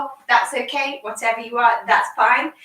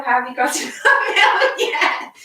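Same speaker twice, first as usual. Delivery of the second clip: laughing; 2 semitones higher, 3 dB louder than usual. Real, not acted. Playful milking game.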